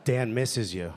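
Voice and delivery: low, smooth love-dedication voice; sweet late-night radio. Acted. Radio host reading a love letter to Stacy, sweet and low. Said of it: "it's sweet. It's like one of those, like, Light F M letters".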